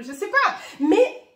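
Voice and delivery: high, loud burst of laughter on the words; real laughing break mid-sentence. Real, not acted. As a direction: laugh it off, pitch jumping up. Laughs at her own hesitation.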